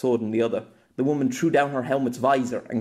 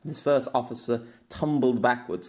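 plain reading voice

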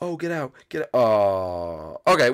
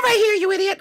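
exasperated sidekick; 6 dB louder than usual. Acted. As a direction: call out the hider in a tiny piercing voice. Sidekick spots him hiding.

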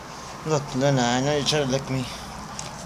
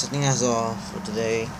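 ordinary delivery

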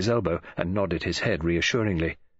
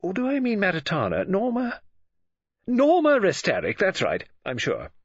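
higher, animated voice of a scatterbrained woman; Mrs Oliver, scatty; 11 semitones higher than usual. Acted. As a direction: think aloud in lively swoops.

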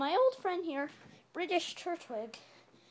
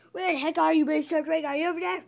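loud, higher call across the house; 3 semitones higher, 9 dB louder, faster than usual. Pretend. Searching for a missing friend, loud calling out. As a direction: shout out for someone hiding.

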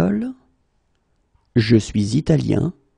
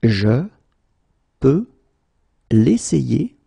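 each word split off by long pauses; slow, word by word; slower than usual. Shown as a demonstration. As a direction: spell out Je, peux, l'essayer one at a time. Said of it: "teacher drills word by word".

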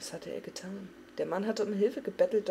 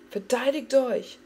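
a higher, louder, slow battle command; shouted command; 6 semitones higher, 9 dB louder, slower than usual. A narrator's reading. Arthas ordering his men to fight the undead, shouting. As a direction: bark the order to your men. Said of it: "schrie Arthas".

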